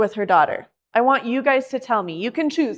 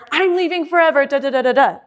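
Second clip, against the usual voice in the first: angry exit; 5 semitones higher, 6 dB louder, faster than usual. Shown as a demonstration. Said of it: "So it's never".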